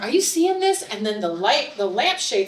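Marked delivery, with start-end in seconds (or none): 0.0-0.8 high-pitched alarmed question. Retold or imitated. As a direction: call out in disbelief. Calling to friend in bathroom.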